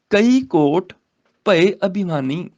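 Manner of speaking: slow, measured verse reading; scripture recitation. Shown as a demonstration. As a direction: recite the sacred verse, drawing out the words.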